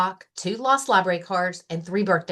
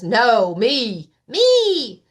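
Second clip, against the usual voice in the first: put-on loud, rising childish insistence; squabbling Rabbit; 3 semitones higher, 7 dB louder, much slower than usual. Rabbit fighting Lion for the map, loud and insistent.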